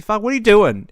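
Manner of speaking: sudden loud, exasperated yell; exasperated outburst. Real, not acted. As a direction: bark it at a bad driver. Angry rant at bad drivers.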